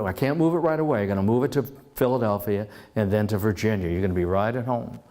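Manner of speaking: ordinary talk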